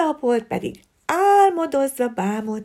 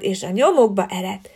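ordinary delivery

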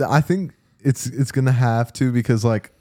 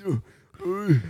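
put-on old man voice; 6 semitones higher, 5 dB quieter than usual. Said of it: "old man voice".

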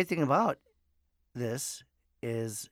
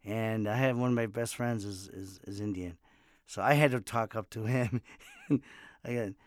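ordinary talk, nothing marked

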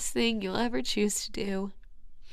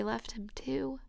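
hushed whisper; 11 dB quieter, slower than usual. Real, not acted. Drops to a whisper.

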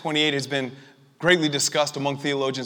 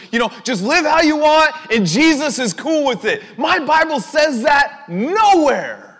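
high-pitched, loud sermon delivery; fiery preaching; 7 semitones higher, 6 dB louder than usual. Real, not acted. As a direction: thunder it out, drawing out the punchlines. Preaches at full force.

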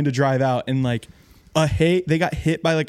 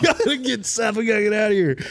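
higher, urgent mock panic; panicked storm chaser; 8 semitones higher than usual. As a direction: play the scared driver fleeing.

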